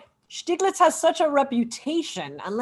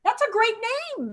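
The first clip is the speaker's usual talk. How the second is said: high, slow admiring line; lightly voiced impressed soldier; soldier's praise; 11 semitones higher, slower than usual. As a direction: savour the compliment. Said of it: "he's like".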